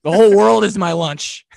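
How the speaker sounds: loud, high goofy beaver puppet; cartoon beaver, playful and loud; goofy muffled sock puppet. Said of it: "I went".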